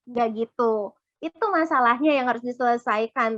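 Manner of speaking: usual speaking voice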